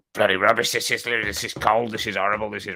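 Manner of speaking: muffled complaint under a polite front; mock grumbling. Diner moaning about cold restaurant food, mock grumbling. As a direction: grumble it in a put-on voice, running the words together.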